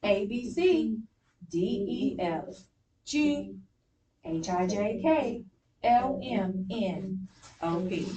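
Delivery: letters in small groups, a pause after "A, B, C" and each group after; group the letters, pausing naturally between groups; phrasing with pauses. Shown as a demonstration. Teacher models pencil swoops for class, phrased with pauses. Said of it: "that's for phrasing".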